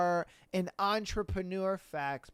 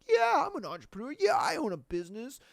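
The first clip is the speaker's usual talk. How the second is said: mock braggart; 5 semitones higher than usual. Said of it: "they're just like".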